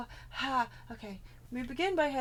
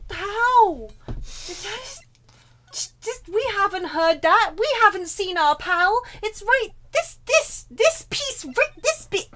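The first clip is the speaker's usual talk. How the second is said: high, loud frantic confusion; frantically hunt for the missing step; exasperated; 8 semitones higher, 8 dB louder, slower than usual. Real, not acted. Stuck, game won't progress.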